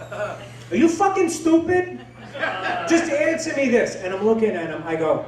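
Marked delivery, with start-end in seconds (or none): 0.1-4.0 exasperated. Retold or imitated. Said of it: "exasperated student to teacher".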